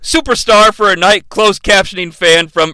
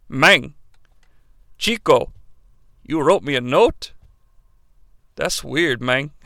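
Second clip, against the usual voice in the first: put-on Razor Ramon accent; 5 semitones lower, 5 dB quieter than usual. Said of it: "his inner monologue saying".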